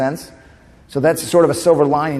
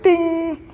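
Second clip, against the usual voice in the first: very high bell chime ding; ping it out like a bell going off; imitated ding. Son mimicking a bell to announce his comeback, high ding.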